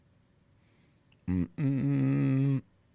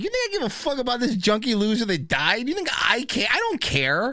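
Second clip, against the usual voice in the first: higher, dismissive Kevin Hart impression; imitating Kevin Hart; 7 semitones higher than usual. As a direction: scoff like a star who doesn't care. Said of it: "the real Kevin Hart's going like".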